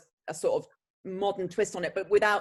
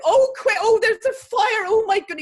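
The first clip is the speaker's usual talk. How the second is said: high, loud, hammed-up West Country panic; terrible West Country accent; 9 dB louder than usual. Actress raising the fire alarm onstage, terrible West Country accent. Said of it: "with my terrible West Country accent going".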